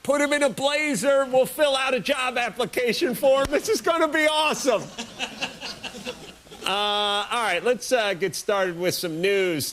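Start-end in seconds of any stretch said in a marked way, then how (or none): 0.0-4.8 gleeful prankster. Retold or imitated. Buddies plotting a prank on drunk Steve-O, giddy and high. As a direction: cackle over the prank plan like a frat buddy. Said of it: "That'd be the ultimate gag".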